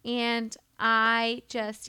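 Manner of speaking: ordinary talk, nothing marked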